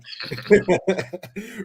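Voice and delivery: high amused laugh. Real, not acted. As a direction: burst out laughing. Laughing at his own joke.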